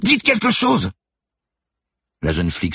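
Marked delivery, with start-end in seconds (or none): 0.0-0.9 high, loud demanding scream; frantic shout. A narrator's reading. Manon begging silent Lucie to speak, frantic shout. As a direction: scream for an answer, beside yourself. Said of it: "s'écria Manon hors d'elle".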